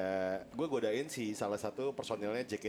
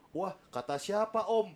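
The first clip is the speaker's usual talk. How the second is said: put-on higher, slow girlish reply; girl's voice; 8 semitones higher, slower than usual. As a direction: play the young idol answering sweetly. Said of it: "Terus dia ngomong gini".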